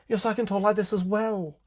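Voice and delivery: high put-on pundit voice; Alex Jones. Podcaster sending up self-styled intelligent men, put-on voice. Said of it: "I feel like Alex Jones now".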